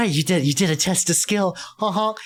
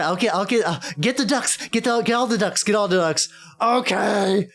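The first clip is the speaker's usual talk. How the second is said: usual speaking voice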